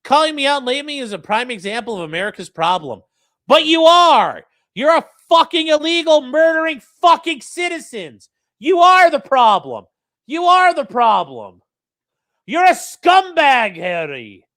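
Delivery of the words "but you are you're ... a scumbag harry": angry shouting. Acted. Streamer yelling at a convicted killer, furious shout. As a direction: scream the accusation at him.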